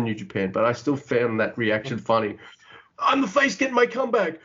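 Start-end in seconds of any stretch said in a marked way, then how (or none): 3.0-4.4 high mock whine of the booed hero; high put-on babyface voice whining at the boos; mock confused wrestler. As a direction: play the baffled face in a high put-on voice. Mocking confused crowd.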